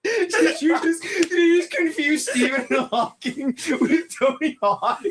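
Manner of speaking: high-pitched, squealing through laughter; laughing hard. Real, not acted. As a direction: crack up in disbelief. Friend mixes up Hawking and Hawk.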